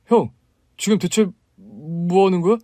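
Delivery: voiced brother-in-law shocked at the scene; shocked outburst. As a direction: burst in alarmed.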